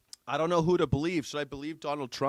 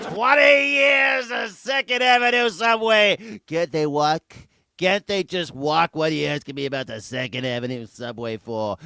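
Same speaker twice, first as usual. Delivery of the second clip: put-on loud New York accent; 2 semitones higher, 8 dB louder than usual. Host mocking Trump on the subway question, hammed-up New York accent. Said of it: "gets his New York accent back".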